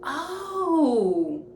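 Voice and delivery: high, startled little oh; a high startled gasp of a word; stunned oh. Retold or imitated. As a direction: caught off guard by the punch. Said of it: "I was like".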